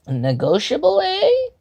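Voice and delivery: a lightly voiced kobold general, smooth and sly; hint of a sly creature leader toying with a guest. A narrator's reading. Kobold general slyly questioning the chosen one.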